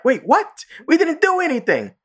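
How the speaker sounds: very high mock protest; high cartoon kids objecting; imitating the kids. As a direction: whine innocently in a squeaky voice. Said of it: "they're like".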